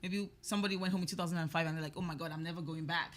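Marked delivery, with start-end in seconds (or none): none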